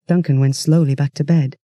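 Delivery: plain reading voice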